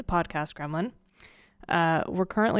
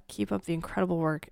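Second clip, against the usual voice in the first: ordinary delivery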